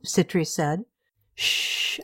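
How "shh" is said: soft whispered shh; hushing whisper. Acted. Aunt hushing her upset niece, whispered. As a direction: hush her gently in a whisper.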